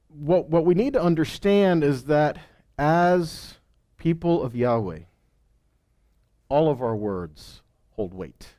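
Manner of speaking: normal talking voice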